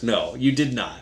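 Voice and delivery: usual speaking voice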